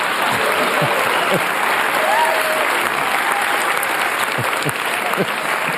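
silent laugh. Real, not acted. Audience-bait laugh break.

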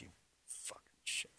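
whispered insult, barely audible; under his breath. Retold or imitated. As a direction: hiss it under your breath. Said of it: "he just kinda says it under his breath".

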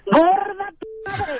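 tearful angry yell; furious shout. Real, not acted. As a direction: yell back at the insult. Wife furious on prank call.

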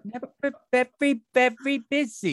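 high, fussy magician voice; imitating Billy DeWolf. Host imitating the Frosty magician, fussy and high. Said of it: "I can hear it from the Frosty".

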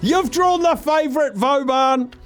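high, thrilled announcement of the favourite; excited reveal. Real, not acted. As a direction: sell it like the big prize.

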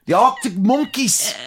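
high, loud, hammed-up northern English; imitated northern accent. Host mocking how he said it, high exaggerated northern accent. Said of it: "How he said it".

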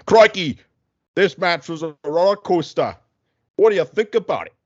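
high, hammed-up Aussie interviewer; Australian accent. Australian interviewer quizzing a tennis player after the match, hammed-up Australian accent. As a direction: put on a big, high-pitched Australian accent. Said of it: "the Australian guy, asked him about it".

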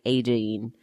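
"eighteen" with the T softened to a muddy D; Australian-style "eighteen", no crisp T; muddy soft D. Shown as a demonstration. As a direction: say "eighteen" like "ay-deen". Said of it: "very muddy, soft D".

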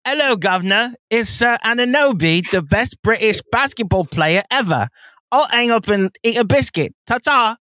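light mock British caller reading a fan message; British accent. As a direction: read it like a cheery Brit on the phone. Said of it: "That's really good".

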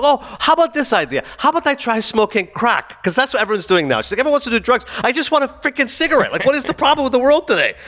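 high-pitched mock rant; exasperated sarcasm. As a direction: imitate a fed-up smoker losing patience. Mother fed up in vape store.

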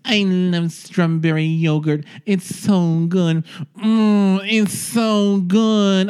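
high, slow, hammed-up sensual impression. Star relishing frozen yogurt in an ad, mock-orgasmic drawl. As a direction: draw out every word in mock ecstasy. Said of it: "she's just like".

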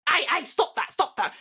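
annoyed outburst. Acted. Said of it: "And he's like".

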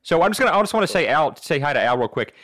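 rambling cartoon dad impression; Peter Griffin. Crasher wheedling past the host at the door, cartoon impression. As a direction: talk your way in with a goofy cartoon voice. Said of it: "in Peter Griffin accent".

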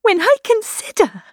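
high, anguished outburst; distraught, pitched high; very agitated. A narrator's reading. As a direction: cry it out in agitation and self-reproach. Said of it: "in a yet more agitated voice".

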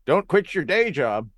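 heckler's jeer. Retold or imitated.

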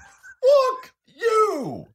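very high, loud screech; mock furious scream. Host blows up over being corrected, mock scream.

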